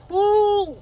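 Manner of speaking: high, loud falsetto "ooh"; piercing exasperated hoot; exasperated whoop. Real, not acted. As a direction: let out a long high ooh. Exasperated at the character.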